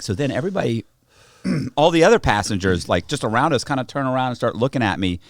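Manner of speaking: ordinary delivery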